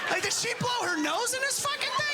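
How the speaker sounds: high, shouting disbelief; grossed-out man. Retold or imitated. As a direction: squeal at the gross discovery. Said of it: "Remember that first discovery?".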